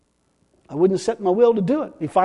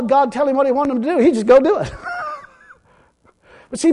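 ordinary talk, nothing marked

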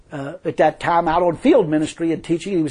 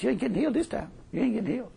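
taunting devil; 4 semitones higher, 10 dB quieter, much faster than usual. Acted. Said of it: "And the devil said".

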